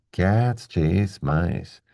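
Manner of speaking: very slow, evenly stressed words; slow regular rhythm. Shown as a demonstration. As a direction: stretch "Cats", "chase", "mice" to one long beat each. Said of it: "keep a regular rhythm going".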